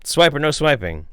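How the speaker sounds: normal talking voice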